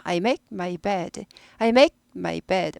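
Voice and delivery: I make my bed, words run together smoothly; 끊지 말고 I make my bed 연결해서; smooth linked speech. Shown as a demonstration. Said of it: "I make, 고급 영어입니다". (부드럽게 이어 읽는 고급 발음)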